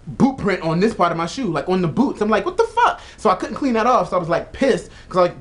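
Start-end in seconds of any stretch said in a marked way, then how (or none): 2.4-3.0 sudden squeal, much higher; shocked. Retold or imitated. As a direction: shriek it in disbelief. Retelling his shock at the footprint.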